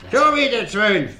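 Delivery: loud, high gloating cry; triumphant at the dice table; excited dice call. Acted. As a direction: crow over the lucky throw. Dice game, rolling twelve again.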